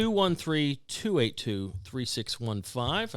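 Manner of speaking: slow, deliberate repeat of the number; slow careful readout. Shown as a demonstration. Repeating the call-in number slowly for listeners. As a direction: spell it out slowly so callers catch it. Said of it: "In that order".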